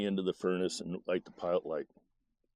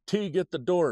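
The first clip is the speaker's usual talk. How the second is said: urgent order; 10 semitones higher than usual. Retold or imitated. Mom carrying a burning barrel, shouting high at her son. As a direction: bark it while hauling the fire.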